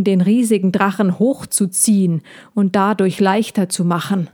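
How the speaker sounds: plain reading voice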